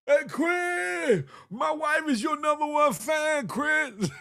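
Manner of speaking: high shouted fan calls; fan yelling. Retold or imitated. Husband yelling to Chris from the crowd, shouting high. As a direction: yell at the stage like a superfan. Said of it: "you gonna be up there".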